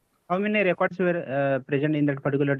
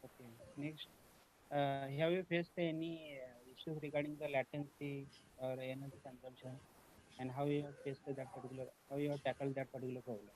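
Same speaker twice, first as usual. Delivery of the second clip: barely audible; 15 dB quieter than usual. Real, not acted. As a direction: speak so softly it barely carries. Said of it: "your voice is not, uh, clearly audible".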